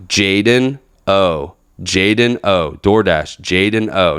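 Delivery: slow, deliberate retold repetition; restrained, not cursing. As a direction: spell it out patiently, holding back the swearing. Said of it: "but I'm not cursing".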